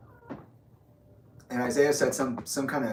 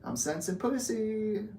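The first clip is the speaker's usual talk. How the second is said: mock psychic voice; 10 semitones higher, 5 dB quieter, slower than usual. Mock mystical comment.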